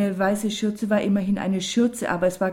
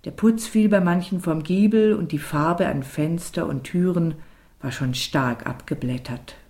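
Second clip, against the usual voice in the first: ordinary delivery, nothing marked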